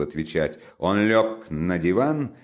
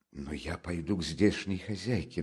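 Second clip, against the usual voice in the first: soft, subdued insistence; hushed but determined; insistent; 10 dB quieter than usual. Acted. Mother insisting she will ask the landlady, softly. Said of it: "настаивала Пульхерия Александровна".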